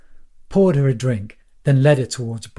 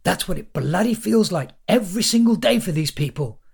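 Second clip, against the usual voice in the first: ranting; 7 semitones higher than usual. Acted. Lawrence ranting at the crowd.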